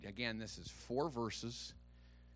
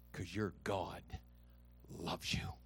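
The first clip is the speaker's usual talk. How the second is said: drops to a whisper on loves you; hushed reassurance; 7 semitones lower, 6 dB quieter than usual. Real, not acted. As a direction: confide it softly to the church. Tender reassurance to congregation.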